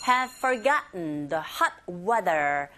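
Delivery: very slow "have forgotten the hot weather" with "have" kept light; slow model with unstressed "have"; weak "have". Shown as a demonstration. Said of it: "have도 약하게 발음하시면".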